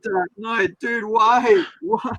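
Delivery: high, anguished comic protest; freaking out. Retold or imitated. Collector protests cutting up hardcover Dark Knight, freaking out high. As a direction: pitch up in disbelief, pleading why. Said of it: "freaking out".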